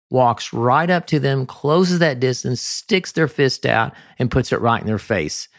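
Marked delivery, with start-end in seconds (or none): none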